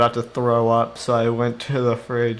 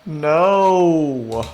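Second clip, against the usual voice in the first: loud, high, drawn-out wail; dismayed cry; 6 semitones higher, 6 dB louder than usual. Real, not acted. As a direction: cry out in mock-tragic dismay. Cries out at the figures' fate.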